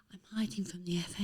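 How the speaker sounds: whispered, hiding from the officials; conspiratorial whisper. Retold or imitated. Footballer hiding, confiding secretly.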